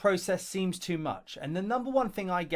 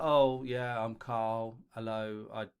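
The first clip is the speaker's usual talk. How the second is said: mock careless mumble, softer and lower; lazy intro; 7 semitones lower, 3 dB quieter than usual. Teacher mocking a careless applicant's intro video, bored mumble. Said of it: "Don't just be like".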